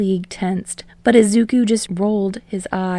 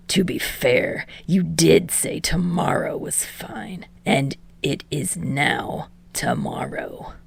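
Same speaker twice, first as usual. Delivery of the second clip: whispered tease; 3 semitones lower, 5 dB quieter than usual. Acted. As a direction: needle him under your breath. Dabi teasing captive.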